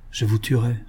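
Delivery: usual speaking voice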